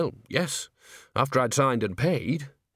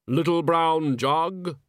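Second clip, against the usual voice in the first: Death voice; 6 semitones higher, much slower than usual. Acted.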